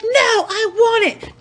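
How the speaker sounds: imitated bratty toddler, very high and stretched; toddler tantrum. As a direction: mimic a small child's loud, drawn-out demand. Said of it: "She's like".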